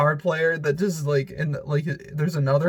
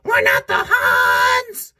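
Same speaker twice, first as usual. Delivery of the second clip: low, slow, loud shout; creature shout; 10 semitones lower, 6 dB louder, much slower than usual. Retold or imitated. YouTuber imitates horned ad creatures with a low, slow shout. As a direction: bellow it like a silly horned hamster. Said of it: "they'd be like".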